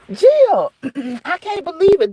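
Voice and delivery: high-pitched, exasperated disbelief; real astonishment; incredulous. Real, not acted. Cannot believe Jill's news.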